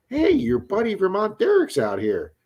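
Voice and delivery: high-pitched mock announcement; imitated heads-up. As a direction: call out the news as your buddy would, voice lifted. Voicing a friend's heads-up text.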